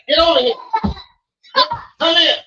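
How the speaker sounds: loud, high-pitched order to come over; shouted summons. Real, not acted. Calling a child over sternly.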